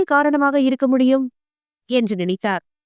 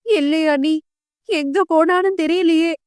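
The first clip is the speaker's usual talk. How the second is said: higher, tearful lament; crying; 5 semitones higher than usual. A narrator's reading. Mother crying that her daughter is missing, a higher tearful wail. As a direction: wail it through tears. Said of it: "என்று பானுமதி அழ".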